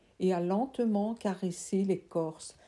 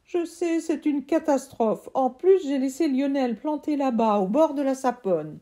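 put-on higher apologetic child voice; a kid pleading to be heard; boy's voice; 7 semitones higher, 7 dB louder than usual. Boy apologising to his uncle, higher pleading voice.

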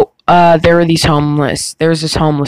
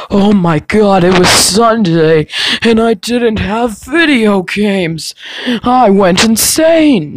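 mock whiny baby voice, drawn out and slow; whiny little baby; 5 semitones higher, slower than usual. Mock whining to the viewers like a little baby. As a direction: ham up a bratty kid. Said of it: "I don't wanna sound like a little baby".

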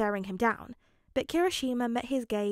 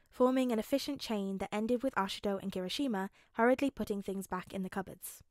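ordinary delivery

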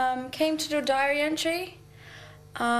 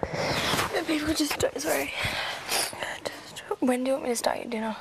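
hushed, tearful whisper; 2 semitones lower, faster than usual. Real, not acted. Servant asking her master about dinner, whispering. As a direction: keep it under your breath.

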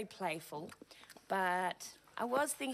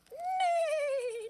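high horse neigh; 3 dB louder than usual. Acted. Horse imitation.

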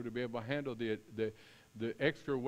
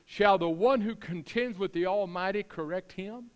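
raised, commanding divine challenge; Lord's voice; 5 semitones higher, 5 dB louder than usual. Acted. God demanding Job's answer, high and stern. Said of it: "the Lord answered Job".

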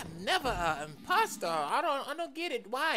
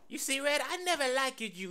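ordinary talk, nothing marked

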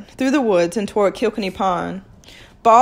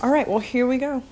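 ordinary delivery

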